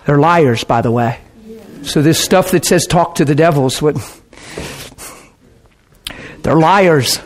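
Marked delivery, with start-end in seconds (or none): 3.9-7.2 laughing. Real, not acted. Repeats line laughing.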